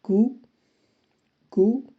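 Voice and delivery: कू, rounded koo sound; French Q. Shown as a demonstration. Teacher demonstrates French Q as कू. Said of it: "क्यू को कू".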